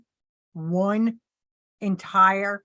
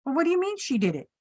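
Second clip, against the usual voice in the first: pitched-up, quick bewildered questions; high puzzled doctors; 8 semitones higher than usual. Retold or imitated. Doctors questioning the husband, high and bewildered. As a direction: mimic confused staff, high and hurried.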